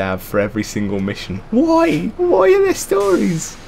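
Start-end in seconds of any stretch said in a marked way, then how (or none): 1.5-3.6 very high-pitched incredulous questioning. Real, not acted. Player baffled that a bus game has stories, incredulous. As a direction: squeak it out in disbelief.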